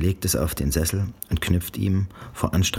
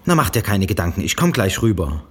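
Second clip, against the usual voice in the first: höher und lauter, keinen Widerspruch duldend; resolute Mutter; 4 semitones higher, 5 dB louder than usual. A narrator's reading. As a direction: bestimme einfach über sie. Mutter drängt Janice. (Mutter kündigt ihren Besuch an, resolut)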